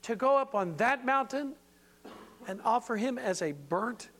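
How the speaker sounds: Abraham begging God to reconsider; pleading Abraham. Retold or imitated. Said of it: "I can almost hear Abraham".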